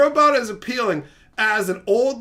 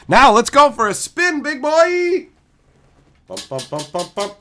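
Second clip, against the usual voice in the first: playful ride; 6 semitones higher, 5 dB louder than usual. Pretend. Playfully revving the spinning wheel.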